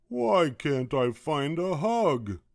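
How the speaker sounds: slow, high, loud complaint; plaintive wail. A narrator's reading. Douglas failing to find a hug, plaintive acted wail. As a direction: wail it out, drawn long.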